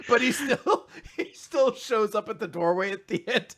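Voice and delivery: ordinary talk, nothing marked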